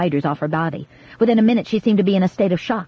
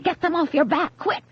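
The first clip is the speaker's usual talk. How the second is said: urgent alarm; 8 semitones higher than usual. A narrator's reading. Grace terrifying the girl about spiders, high and urgent. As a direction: paint the spiders to terrify her. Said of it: "Grace shouted again".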